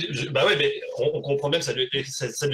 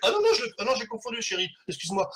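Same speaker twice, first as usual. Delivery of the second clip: flustered husband; 8 semitones higher than usual. Acted. Husband caught out, acted.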